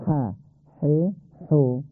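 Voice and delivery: ح and حو rasped from the throat, like clearing it. Shown as a demonstration. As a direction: give ح a scraping throat-clearing rasp. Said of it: "مانند صدای گلو صاف کردن". (ح و حو با صدای صاف کردن گلو)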